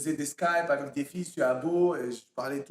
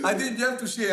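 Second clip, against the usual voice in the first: laughing, high and breaking; en riant; 5 semitones higher, 4 dB louder than usual. Real, not acted. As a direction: talk through a burst of laughter. Laughing through his answer. (rire qui déborde sur les mots)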